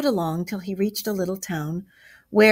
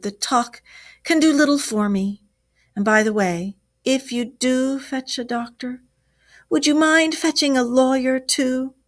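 higher, plaintive request; plaintive Toad; 7 semitones higher, 5 dB louder than usual. A narrator's reading. Toad asks Rat to fetch help, plaintively. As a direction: plead politely, pitched well up.

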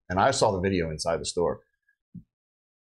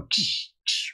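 whispered ricochet noise; hushed ksh of rounds hitting stucco; imitated ricochet. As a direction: mimic bullets skipping off cement. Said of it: "I could see him going".

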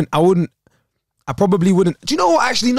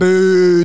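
loud, drawn-out boo; held, louder "boo" noise; imitated editing effect; 3 semitones higher, 8 dB louder than usual. Shown as a demonstration. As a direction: mimic an edit sound effect, long and loud.

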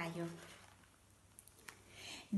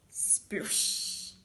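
unvoiced, hushing "splush"; imitated splat. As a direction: make a wet splat with the mouth. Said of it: "io la faccio così". (imitazione di uno spruzzo)

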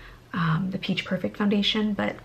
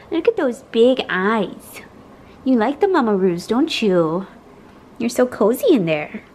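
slow tender coo; 3 semitones higher, 8 dB louder than usual. Real, not acted. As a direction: linger over the words, cooing. Cooing at babies.

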